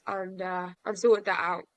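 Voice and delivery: normal talking voice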